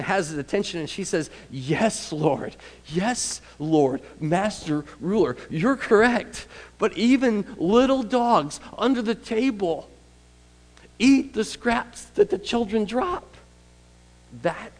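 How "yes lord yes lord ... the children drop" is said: rising, impassioned plea; pleading woman. Acted. Preacher voicing the woman begging Jesus, rising plea. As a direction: beg humbly but fervently.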